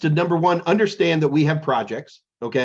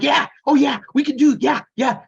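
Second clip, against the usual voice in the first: eager nerd; 10 semitones higher, 3 dB louder than usual. Retold or imitated. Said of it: "then I'm like".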